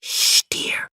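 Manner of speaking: hushed whispered shush. A narrator's reading. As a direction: whisper so nobody wakes. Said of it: "she whispered".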